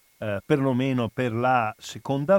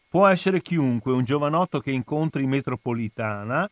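newspaper reading; 2 semitones higher than usual. Acted. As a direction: read the article out with weight. Reads newspaper article aloud.

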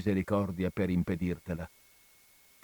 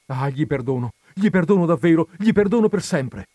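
louder and higher with each gli perdono; exclaimed; 7 semitones higher, 8 dB louder than usual. Acted. Renzo desperately swears forgiveness to the friar: rising loud exclamation. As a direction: burst out, climbing to a cry. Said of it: "esclamò il giovine".

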